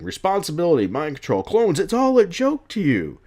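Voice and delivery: high, loud angry accusation; acted-out indignant outburst; mad Jonah Jameson. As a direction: throw it out as an exasperated rebuke. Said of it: "gets Jonah mad".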